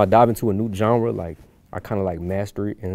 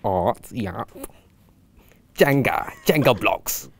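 put-on British accent; much slower than usual. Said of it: "Do a British accent for the rest of the video".

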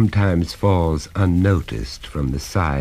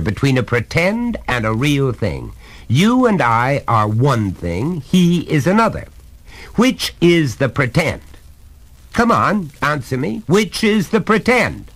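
much higher, mounting emphasis; exasperated, pitched-up father; insistent lecturing father; 10 semitones higher, 4 dB louder than usual. Acted. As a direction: drive the point home to a child.

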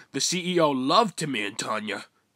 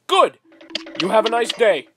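loud sarcastic; 9 semitones higher, 7 dB louder than usual. Acted.

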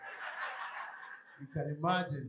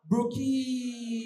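swaggy hawker call; 4 dB quieter than usual. Retold or imitated. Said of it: "Then they add the swag".

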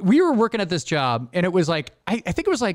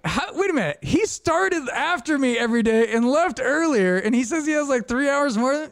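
higher, protesting the hours; indignant disbelief; 7 semitones higher than usual. Retold or imitated. Complaining to the boss about a coworker's hours, indignant. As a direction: wait a minute, pitched up in protest. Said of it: "And I'm like".

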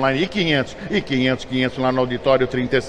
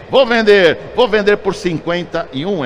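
loud call; 5 semitones higher, 4 dB louder than usual. Real, not acted. Auctioneer calls out sale.

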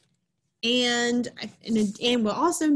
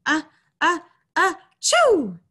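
big drawn-out sneeze building up; sneezing; 7 semitones higher, 4 dB louder, slower than usual. Acted. Avery sneezing as he climbs onto his horse. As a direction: wind up and sneeze it out. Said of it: "sneezed Avery".

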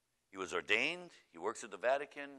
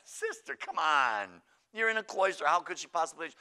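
high, loud acted scoffing; loud, incredulous protest; exasperated disbelief; 7 semitones higher, 10 dB louder than usual. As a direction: talk her out of it forcefully. Priest dismisses the nun's worry.

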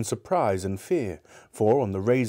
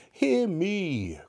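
ghost crying out; 10 semitones higher than usual. A narrator's reading. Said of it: "cried the ghost".

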